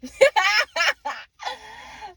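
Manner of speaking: long, loud high cackle; laughing. Real, not acted. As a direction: burst into high-pitched laughter. Own laugh at the reading.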